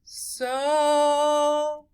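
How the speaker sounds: So held long with a long O; teacher stretching the long O in so. Shown as a demonstration. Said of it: "We say O at the end".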